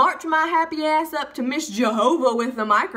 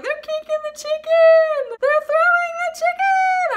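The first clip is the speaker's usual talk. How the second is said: hammed-up high-pitched wail; mock hysterical crying, way up high; 6 dB louder, slower than usual. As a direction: wail it loud and slow like a bawling child. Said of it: "crying because I'm like".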